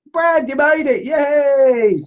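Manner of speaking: high, loud welcoming shout; excited cheer. Real, not acted. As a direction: squeal a big hello. Cheering a viewer's arrival.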